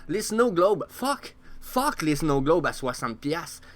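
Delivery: high, loud, slow exclamation; incredulous high-pitched swearing; loud disbelief. Real, not acted. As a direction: burst out in outraged disbelief. Outraged at Snow Globe prices.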